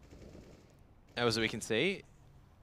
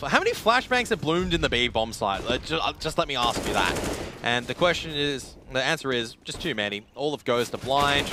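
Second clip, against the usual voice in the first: raised, higher esports call; live play-by-play; 5 semitones higher, 5 dB louder than usual. Acted. Caster asks viewers about flashbangs in B site, raised play-by-play. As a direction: fire rhetorical questions over the action.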